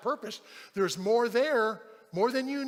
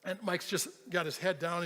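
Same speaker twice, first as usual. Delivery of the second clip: usual speaking voice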